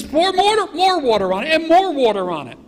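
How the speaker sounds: high, loud, insistent command; shouted order. Acted. Elijah ordering more water poured.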